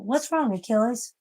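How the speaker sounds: high cooing pet talk. Real, not acted. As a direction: coo up at the pet. Baby-talking to the cat.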